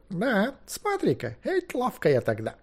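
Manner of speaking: slow, high, marvelling to himself; drawn-out pleased wonderment; self-satisfied wizard. Acted. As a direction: admire your own old trick. Old wizard marvels at own trick.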